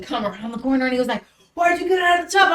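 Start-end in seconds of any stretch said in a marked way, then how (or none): 1.5-2.5 high-pitched shouted demand; acted-out yelling; retold yelled question. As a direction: yell it across the house, imitating an alarmed partner. Acting out partner's alarmed scolding.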